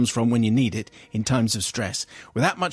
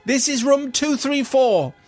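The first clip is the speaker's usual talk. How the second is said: high, measured British telephone voice; best calm British voice; 11 semitones higher than usual. Acted. Guest phoning reception about a snake, high strained British calm. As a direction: hold a high, over-controlled calm. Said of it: "In my best calm British voice".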